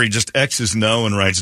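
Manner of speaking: ordinary talk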